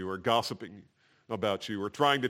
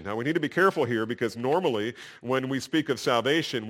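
ordinary talk

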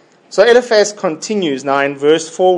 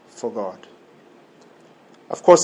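normal talking voice

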